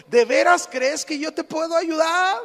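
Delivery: high, loud booming question; God's challenge, projected loudly. Retold or imitated. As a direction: call down to the climber from on high. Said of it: "se oyó una voz y le contestó".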